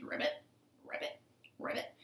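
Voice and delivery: small toneless pig ribbit. Acted. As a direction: let out little croaked ribbits. Pig making frog noises.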